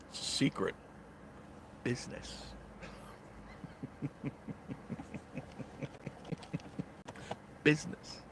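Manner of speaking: hushed secret whisper. Real, not acted. Naming his channel Secret Business in a whisper. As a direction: breathe it like a secret. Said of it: "Hence the name".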